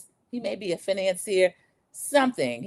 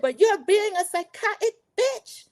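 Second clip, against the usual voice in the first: high mock-scolding accent; hammed-up high argument voice; Martha arguing; 12 semitones higher than usual. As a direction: imitate a dramatic friend's reproach, pitched way up. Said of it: "trying to do their accents and these loud, horrible arguments".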